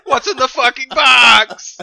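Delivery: loud, slow wrestling promo shout; Al Snow impression. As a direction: bellow it like a wrestler. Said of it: "You're Al Snow".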